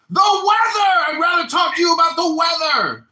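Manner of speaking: high loud repeated outburst; exasperated shout. Real, not acted. Fed up with race talk, shouting about the weather. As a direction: yell it in disbelief.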